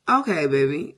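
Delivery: loud sassy hype; sassy drag queen. Acted. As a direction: serve it with attitude. Hyping herself in mirror.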